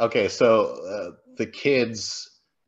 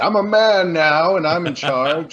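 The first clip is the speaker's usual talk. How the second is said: mock bravado; 7 semitones higher, 5 dB louder than usual. Pat declaring himself in charge the next morning, mock bravado. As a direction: proclaim it like a puffed-up new man. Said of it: "that's when Pat's like".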